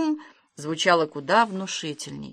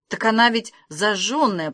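ordinary delivery, nothing marked

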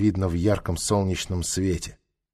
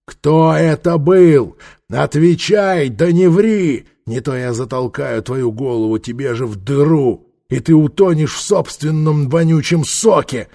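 loud, menacing interrogation; furious; 4 semitones higher, 6 dB louder than usual. A narrator's reading. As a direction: threaten a cowering prisoner. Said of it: "Лицо Трока потемнело от ярости".

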